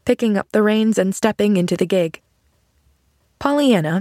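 ordinary delivery, nothing marked

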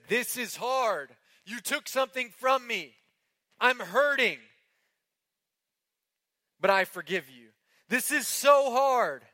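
a touch of tearful pleading, high and loud; voicing a grieving victim. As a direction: speak as a grieving family member forgiving the killer. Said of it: "They said".

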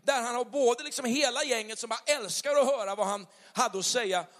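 preaching fervour, pitch up; rising preaching. Acted. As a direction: lift the congregation, building. Predikar med kraft. (predikan med stigande glöd)

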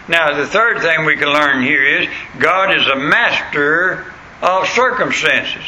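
usual speaking voice

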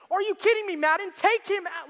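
high, loud exasperated yell at the manager; frustrated fan. Retold or imitated. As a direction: shout at the TV like a fed-up fan. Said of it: "and I'm thinking".

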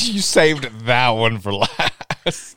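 half-laughed and drawn out very slowly; laughing. Real, not acted. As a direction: laugh through the words, stretching them. Repeating the joke while laughing.

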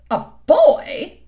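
loud, high surprised cry; startled queen. A narrator's reading. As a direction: react sharply in shock. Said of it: "she said".

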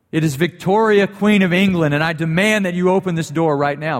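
the queen pounding the door, insisting; angry demand. Retold or imitated. Queen Victoria demands her husband open the door, loud and imperious. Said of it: "And she was angry".

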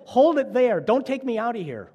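small voice begging to stay put; pleading candle voice. Acted.

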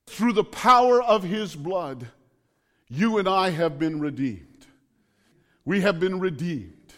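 ordinary talk

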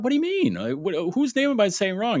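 a high, incredulous what do you mean; shocked disbelief. Retold or imitated. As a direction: pitch jumps way up in disbelief. Acting own shocked reply.

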